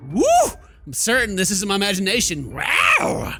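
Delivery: normal talking voice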